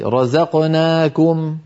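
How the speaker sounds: رزقناكم without extra lengthening, slowly; correct short vowels. Shown as a demonstration. Teacher correcting a student's over-lengthening of رزقناكم. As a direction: say رزقناكم with no added madd. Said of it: "لا تمدي رزقناكم زيادة".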